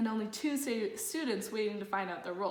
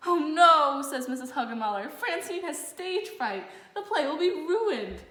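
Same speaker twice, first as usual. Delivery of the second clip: panicky teacher; 6 semitones higher, 6 dB louder than usual. A narrator's reading. Teacher panics in Olivia's dream.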